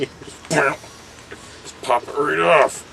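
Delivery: very slow, high drawn-out threat; mock menacing. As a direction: stretch it out like a cartoon villain. Mock violent boast.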